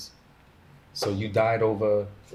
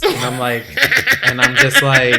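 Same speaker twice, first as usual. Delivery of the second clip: laughing; 14 dB louder than usual. Real, not acted. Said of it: "I start dying laughing".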